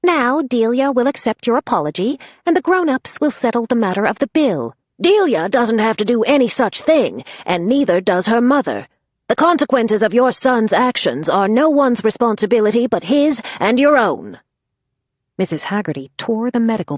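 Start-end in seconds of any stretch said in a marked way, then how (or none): 0.0-4.8 fake diplomatic smile. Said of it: "pasting on a diplomatic smile". 5.0-14.4 loud firm reprimand; stern rebuke. Acted. Grandmother rebukes the mother.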